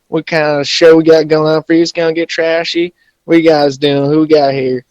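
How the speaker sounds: loud hillbilly podcast host. Acted. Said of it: "intro like a redneck".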